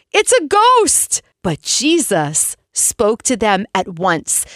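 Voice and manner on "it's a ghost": frightened cry, high and slow; cried out. A narrator's reading. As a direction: cry out in fear of a ghost. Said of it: "they cried out".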